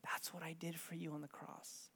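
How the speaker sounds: very soft, low whispered revelation. Retold or imitated. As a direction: whisper it as the Holy Spirit would. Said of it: "just whispers to me".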